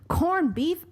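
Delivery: put-on a quick, high stab at an Irish accent, dropped at once; aborted Irish accent. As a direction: start the line with a half-hearted Irish lilt and give up. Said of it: "I can't do an Irish accent".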